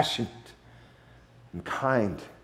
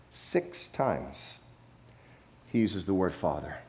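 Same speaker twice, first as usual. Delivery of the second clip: ordinary talk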